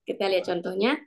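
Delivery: ordinary delivery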